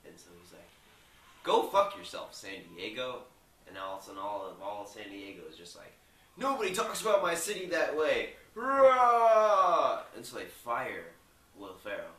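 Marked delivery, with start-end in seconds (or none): 1.4-3.2 proud loud sign-off. Retold or imitated. Ron reading the teleprompter on air, loud. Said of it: "so he reads it". 6.4-10.0 higher, much louder, ending in a roar; angry crowd roar. Retold or imitated. The whole city furious at Ron, roaring. San Diego crowd rages.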